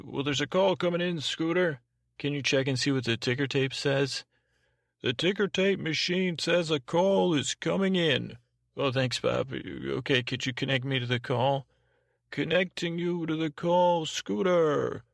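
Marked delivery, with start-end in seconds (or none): 0.0-1.8 higher-pitched skit operator announcing. Pretend. Host as operator alerts Scooter to a call, high operator voice. 5.0-8.4 high, sing-out switchboard operator; operator announcer. Pretend. Bob reports the ticker tape to Scooter, high announcer voice. 12.3-15.0 high, put-on switchboard voice; operator announcer. Bob connects Scooter to the caller, high operator announcer voice. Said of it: "this is having an operator, announcer".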